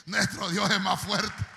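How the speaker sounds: rally the crowd at the top of your voice; rousing preacher shout. Real, not acted. Preacher demanding amens from the congregation, shouting.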